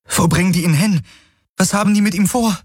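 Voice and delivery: high, frantic questions; anxious. A narrator's reading. Kirai demands of Hawks where they take Colin, high and frantic. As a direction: ask him in rising alarm.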